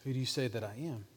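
soft, quick, intimate question; gentle nudge. Acted. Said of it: "I hear Jesus nudge me and say".

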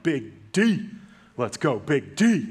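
loud, pumped call with a slow stretched finish; hype greeting. Retold or imitated. Dad loudly hyping up his son at the door. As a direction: hype him up as he walks in.

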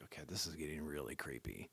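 low, hushed, creeped-out reaction; uneasy aside. Retold or imitated. Teenager privately reacting to a stranger's affection, uneasy aside. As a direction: drop your voice as if thinking it privately.

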